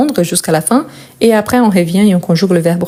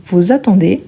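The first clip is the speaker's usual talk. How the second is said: usual speaking voice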